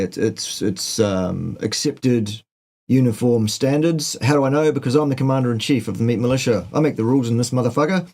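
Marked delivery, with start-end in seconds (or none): 4.2-8.1 fast. Acted. Mock commander boasting, rushed.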